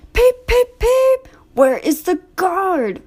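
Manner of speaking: high, loud peeping whistle; piercing high engine peep; whistling engine. A narrator's reading. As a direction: whistle it like a train. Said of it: "whistled Thomas".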